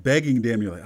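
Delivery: ordinary talk, nothing marked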